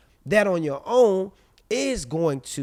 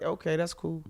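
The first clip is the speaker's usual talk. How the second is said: softer, unbothered reply; unbothered acceptance; 10 dB quieter than usual. Retold or imitated. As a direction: brush it off quietly. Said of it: "I don't react to that no more".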